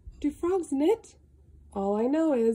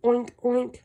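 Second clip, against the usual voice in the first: louder grunting oinks; pig oink; 4 dB louder than usual. Acted. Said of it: "What do pigs say?".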